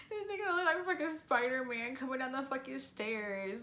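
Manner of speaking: high, laughing delivery; real amusement bursting into speech; laughing while talking. Real, not acted. Cracks up describing him on stairs.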